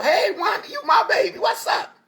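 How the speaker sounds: a playful happy shout to Wanda; excited greeting. Retold or imitated. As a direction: call out to her like you're overjoyed. Acting out a warm greeting.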